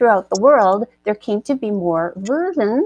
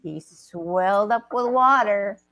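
story narration; 6 semitones lower, 5 dB quieter, slower than usual. A narrator's reading.

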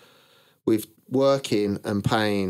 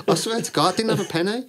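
laughing; 10 semitones higher, 5 dB louder, faster than usual. Real, not acted. Still laughing about being broke.

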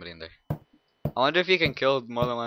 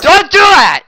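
very high, loud scream; shrieked command at the victim; furious shout; 19 dB louder than usual. Acted. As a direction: scream at them to stop. Yelling at victim.

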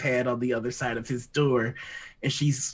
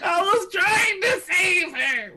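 laughing cry; 4 dB louder, slower than usual. Real, not acted. Laughing through a sad memory.

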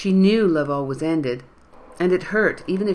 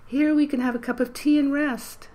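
much higher, frail granny voice; high quavery old woman; 11 semitones higher than usual. A narrator's reading. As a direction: croon it like an elderly lady. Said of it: "in a high, quavery voice".

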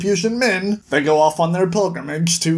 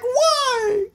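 exasperated why. Retold or imitated. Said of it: "and I'm just like".

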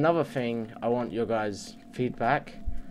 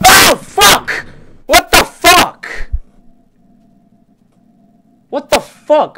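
high screaming panic, much louder and higher; shocked scream; 21 dB louder, much slower than usual. Real, not acted. Creeper blows him up from behind, panicked scream. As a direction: shriek as the creeper explodes.

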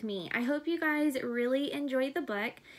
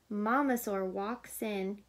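ordinary delivery

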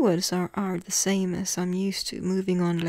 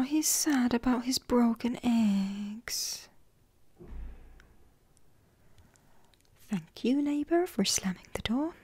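ASMR whisper; 3 semitones higher, 4 dB quieter than usual. Acted. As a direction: whisper it like a sad secret. Whispered card reading continues.

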